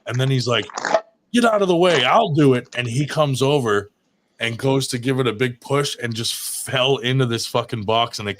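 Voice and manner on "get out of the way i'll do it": higher, louder mock bark at the kids; impatient driver. Fed-up driver to the pranksters, a raised impatient bark. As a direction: play the fed-up driver shoving in.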